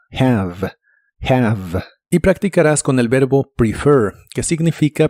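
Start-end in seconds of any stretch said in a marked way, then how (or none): 0.1-0.7 "Have" with a breathy soft Spanish j at the start; "Have" starting on a gentle throat j. Shown as a demonstration. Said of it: "La H se pronuncia como una J suave". 1.2-1.8 "have" with a soft Spanish j sound first; "have" breathed from the throat. Shown as a demonstration. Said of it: "La H se pronuncia como una J suave".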